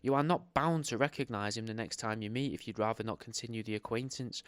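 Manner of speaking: ordinary talk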